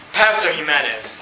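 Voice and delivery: low, drawn-down mock critic; put-on low voice of a scolding critic. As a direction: address the pastor as a disapproving know-it-all. Mimics a critic addressing him.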